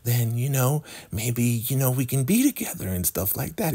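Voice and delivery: high woman's voice, hopeful and coaxing; pleading woman. Retold or imitated. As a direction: coax him in a high girlish voice. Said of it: "She's like".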